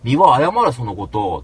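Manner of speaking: loud shouted demand; mock furious yell; retold angry demand. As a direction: bark the apology demand. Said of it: "つって言ったわけ".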